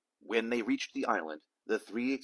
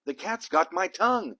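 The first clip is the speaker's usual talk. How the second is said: high, loud squeaky cry; panicked squeak; 9 semitones higher, 6 dB louder than usual. A narrator's reading. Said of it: "squeaked the butcher".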